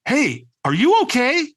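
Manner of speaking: high, drawn-out puzzled check-in; puzzled concern. Retold or imitated. Trainer checking on a man hiding at the back, puzzled. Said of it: "gives me this puzzled look".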